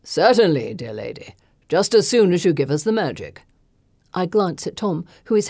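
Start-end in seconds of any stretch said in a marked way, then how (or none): 0.0-3.4 smooth, mocking aristocrat; mocking lord. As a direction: toy with her politely. Lord mocks her.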